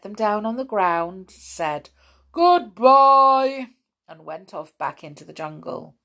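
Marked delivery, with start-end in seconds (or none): 2.3-3.7 loud, high booming farewell; elephant voice. A narrator's reading. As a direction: trumpet a big goodbye. Elephant says goodbye.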